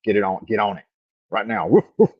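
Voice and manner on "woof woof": high, loud playful woof woof; mock dog barking. Streamer barking at chat to hit like. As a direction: bark like a dog at the viewers. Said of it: "Like Pavlov's dog".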